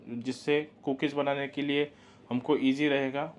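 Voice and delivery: ordinary talk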